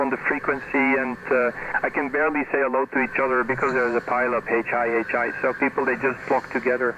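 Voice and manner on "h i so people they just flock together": ordinary delivery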